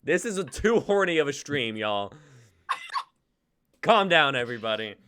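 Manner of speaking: loud, high mock telling-off; host loudly hushing a rowdy chat; mock scolding. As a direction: shout it up high, pretending to scold.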